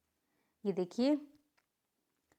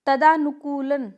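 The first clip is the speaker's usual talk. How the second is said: wrong word read out; 3 semitones higher, 7 dB louder, slower than usual. Shown as a demonstration. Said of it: "तो ये क्या होगा?".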